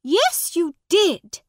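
high, loud retort; woman contradicting the boy; insisting. A narrator's reading. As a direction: snap back at him. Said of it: "she said".

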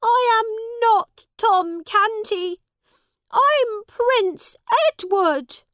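high, loud desperate cry; cried out. Acted. Prince cries out to the man who won't believe him, high and loud. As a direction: beg him to believe you. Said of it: "cried the prince".

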